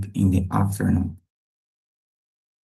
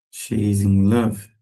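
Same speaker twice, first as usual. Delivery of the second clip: ordinary talk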